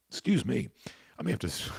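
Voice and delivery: talking through the hiccups; hiccupping mid-sentence. Real, not acted. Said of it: "I've got the hiccups".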